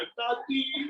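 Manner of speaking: high, drawn-out mock child's wail; imitating wailing children. Grandchildren clinging to grandmother's legs, mock crying. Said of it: "रोने लगे".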